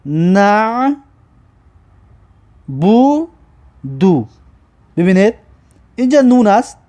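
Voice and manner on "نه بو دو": "نه", "بو", "دو" each alone with long pauses; slow dictation, one syllable at a time. Shown as a demonstration. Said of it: "مینویسید که".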